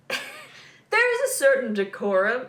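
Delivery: slow, mock British accent; Nick's British accent. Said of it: "I'm not gonna try and do the accent".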